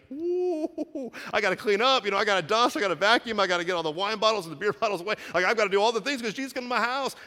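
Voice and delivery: host panicking before Jesus arrives; flustered Matthew. Pretend. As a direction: scramble to tidy before the guest.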